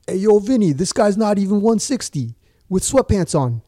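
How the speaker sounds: loud, high Italian-American wiseguy imitation; mock Italian accent. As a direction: call out in a hammed-up Italian accent. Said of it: "if I could do an Italian accent".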